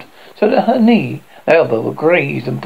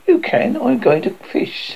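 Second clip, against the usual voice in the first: put-on higher, mildly bold boy's line; 7 semitones higher than usual. A narrator's reading. Peter telling his sisters his plan, mildly bold. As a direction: state it boldly, lightly acted. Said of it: "said Peter boldly".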